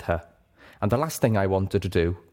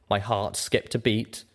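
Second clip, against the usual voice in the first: ordinary delivery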